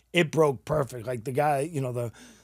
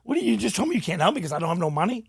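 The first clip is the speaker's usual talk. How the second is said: retold anger; 6 semitones higher, 3 dB louder, faster than usual.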